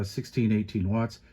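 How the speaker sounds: normal talking voice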